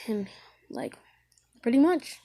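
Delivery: ordinary delivery, nothing marked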